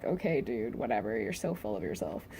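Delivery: low, quiet, unimpressed aside; dismissive retort. Retold or imitated. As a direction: brush off the braggart under your breath. Unimpressed retort to the bragging character.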